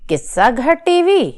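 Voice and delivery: high, loud puzzled echo; surprised question. Acted. Mother hearing about the channel for the first time, surprised. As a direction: repeat the name as if never heard of it.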